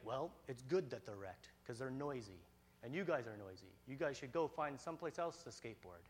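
lightly voiced grouchy neighbor; dismissive neighbor. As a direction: brush the kids off, low and unimpressed.

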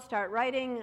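ordinary delivery

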